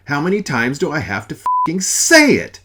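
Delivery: loud exasperated yell, pushed high; furious yell. Acted. Father ranting at Mother, furious yell. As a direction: hammer each word in fury.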